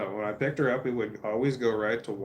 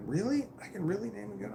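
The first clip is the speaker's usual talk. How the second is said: high-pitched imitation of an incredulous girl; surprised daughter; 11 semitones higher, 3 dB quieter than usual. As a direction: mimic her amazed question, voice way up. Acts out daughter's delighted disbelief.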